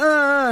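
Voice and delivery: high, loud imitated yelp of pain; mock pained scream. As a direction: yelp like you've been floored. Beaten man cries out.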